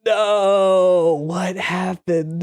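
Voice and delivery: startled, stretched-out "what happened"; shocked disbelief. Retold or imitated. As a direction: react like you just watched your buddy drop dead. Reacting to friend's fatal fall.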